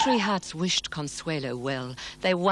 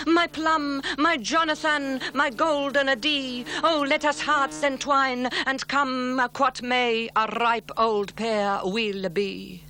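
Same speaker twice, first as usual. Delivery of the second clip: lovestruck serenade; 9 semitones higher than usual. Pretend. Leo imagining his proposal, higher ardent and slowing. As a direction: pour out the whole heart like a smitten suitor. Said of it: "did he cry".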